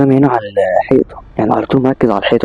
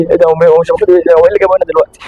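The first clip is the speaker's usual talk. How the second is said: startled panic; 4 semitones higher, faster than usual. Acted. Friend shouts at entrance.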